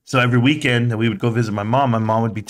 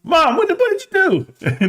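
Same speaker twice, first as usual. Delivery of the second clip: put-on shocked kid. Boy to his mom, high-pitched shock. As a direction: blurt it out in shock.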